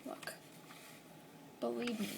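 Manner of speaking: ordinary delivery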